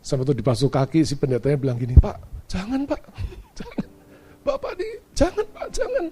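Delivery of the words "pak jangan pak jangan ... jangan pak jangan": high, halting plea; mock pleading. Pastor refuses to have his feet washed, acted pleading. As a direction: imitate a distressed man begging him to stop.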